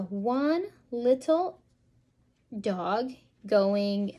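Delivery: slow, lilting picture-book read; reading to children. Shown as a demonstration. Parent reading a counting book to a toddler, slow and sing-song. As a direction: draw the little listener into each page.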